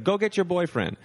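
playful order. Retold or imitated. Comic ordering the girlfriend to fetch the bassist, in a high playful voice. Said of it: "He's like".

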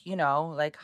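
usual speaking voice

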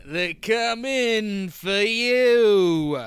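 slow, ominous trailer-announcer delivery, stretching every word; movie trailer voice. Acted. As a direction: tease the threat like a film promo, very slowly. Dramatic trailer-style show intro.

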